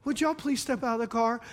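a hammed-up gentle officer asking nicely; mock polite cop. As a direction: sweet-talk the driver like a too-gentle cop. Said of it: "They yell".

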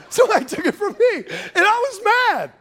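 laughing high through the words; cracking up at the irony of it. Real, not acted. Preacher laughs telling congregation his mower got stolen.

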